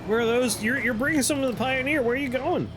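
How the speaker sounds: high, fast squeaky VTuber voice; cute anime streamer. Acted. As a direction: squeal it like a flustered anime girl. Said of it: "makes us talk like a VTuber".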